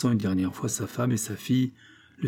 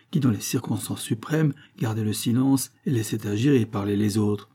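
ordinary delivery

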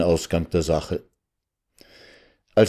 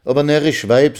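lightly voiced husband, higher-pitched chiding; a touch of reproach to his wife; exasperated husband; 7 semitones higher, 3 dB louder than usual. (leicht vorwurfsvoller Ehemann)

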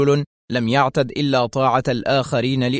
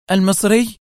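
high, louder burst of disbelief; excited exclamation; 8 semitones higher, 5 dB louder than usual. A narrator's reading. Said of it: "وهي تهتف". (هتاف منفعل)

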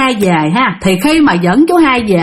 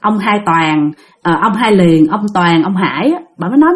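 usual speaking voice

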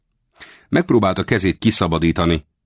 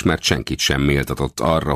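usual speaking voice

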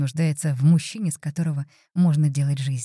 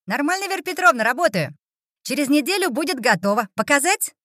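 put-on teen boy voice; 9 semitones higher than usual. Teen boy answers visitor.

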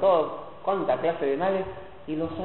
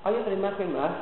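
ordinary delivery

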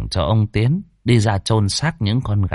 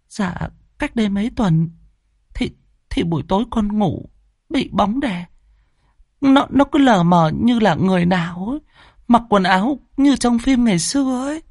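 put-on much higher, timid teenage girl recounting her nightmare; young girl telling; 13 semitones higher than usual. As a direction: tell it like a scared schoolgirl, voice raised high. Daughter recounts ghostly visits.

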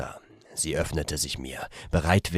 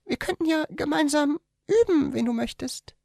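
much higher, teasing female voice; giggling young woman. A narrator's reading. The woman teases him, much higher and playful. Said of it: "meinte sie kichernd".

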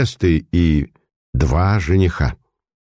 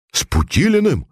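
high, startled gasp of a question; frightened cry; 10 semitones higher than usual. A narrator's reading. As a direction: blurt the name out in fright. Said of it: "испуганные возгласы".

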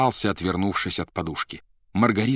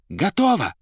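high, louder single-word call; 12 semitones higher, 5 dB louder than usual. A narrator's reading. Gella after hiding the card, high call. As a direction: sing out that it is done. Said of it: "крикнув".

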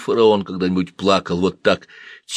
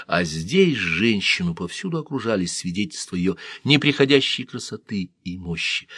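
ordinary delivery

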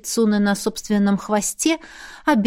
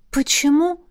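higher-pitched puzzled question; surprised; 6 semitones higher than usual. A narrator's reading. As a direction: ask it in mild surprise. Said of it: "удивилась Беата". (удивлённо)

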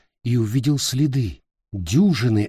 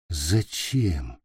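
low, soft, lifeless question; flat lifeless voice; 6 semitones lower, 5 dB quieter than usual. A narrator's reading. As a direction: ask with all hope gone. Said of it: "Ровным, безжизненным голосом".

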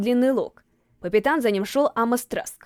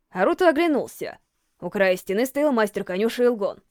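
ordinary delivery, nothing marked

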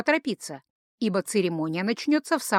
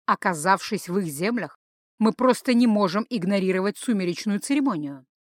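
ordinary delivery

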